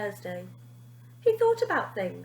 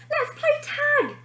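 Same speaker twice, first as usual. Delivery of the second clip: yell; 4 dB louder than usual. A narrator's reading. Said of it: "yelled Basil".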